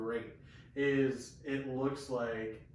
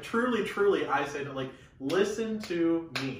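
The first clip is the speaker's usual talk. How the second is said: loud, raised, insistent plea to listen; heated and emphatic, pitched up and pressing; mildly exasperated, demanding to be heard; getting heated; 5 semitones higher, 6 dB louder than usual. Acted. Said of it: "he's getting heated".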